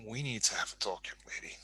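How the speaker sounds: stern hushed whisper. Acted. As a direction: scold her in a whisper. Stern in-character whisper to her.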